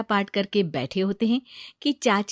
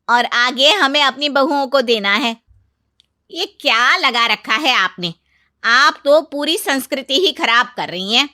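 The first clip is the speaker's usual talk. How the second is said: loud, higher-pitched remonstrance; indignant aunt-in-law; 4 semitones higher, 8 dB louder than usual. Acted. Aunt-in-law accuses her sister-in-law of spoiling the culture, indignant. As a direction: insist forcefully on tradition.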